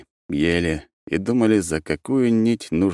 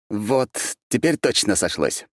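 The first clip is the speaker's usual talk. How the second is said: ordinary delivery, nothing marked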